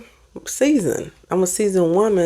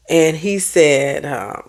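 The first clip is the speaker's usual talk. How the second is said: normal talking voice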